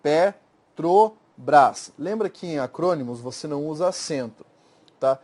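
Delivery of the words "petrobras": Petrobras drawn out syllable by syllable; teacher showing Petrobras is syllabifiable; slow syllabified Petrobras. Shown as a demonstration. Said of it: "é silabável".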